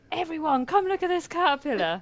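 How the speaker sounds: high-pitched excited summons; re-enacted excited call; retold calling out. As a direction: call the whole group over, pitched well up. Retold calling everyone over to see.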